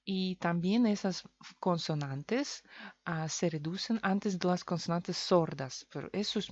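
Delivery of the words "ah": ordinary talk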